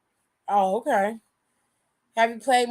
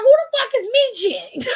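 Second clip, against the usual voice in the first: pitched way up and shouted; high mock shout; 10 semitones higher, 6 dB louder than usual. Jimmy shouting back at AJ Styles, high and loud. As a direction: yell it high and loud in disbelief. Jimmy mocking AJ's girl.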